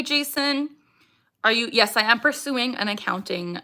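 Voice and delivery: normal talking voice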